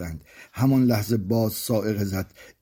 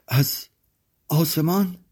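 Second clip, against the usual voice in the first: halting, rising high in alarm; terrified question; 7 semitones higher, slower than usual. Acted. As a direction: echo it as a frightened child's question. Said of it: "کودکم وحشت زده تر شد".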